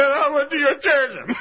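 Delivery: high, slow, loud half-sobbed; crying through the words; tearful. Retold or imitated. Ruth to preacher: tearful, high and slow. Sobbing woman telling story.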